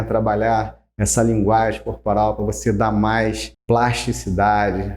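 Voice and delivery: ordinary delivery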